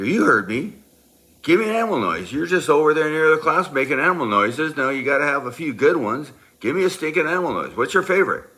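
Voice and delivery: high, loud mock-angry tirade; shouted berating. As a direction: yell demands at full tilt, as retold. Berating Bob after threatening detention.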